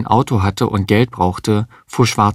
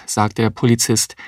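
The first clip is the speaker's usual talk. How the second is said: plain reading voice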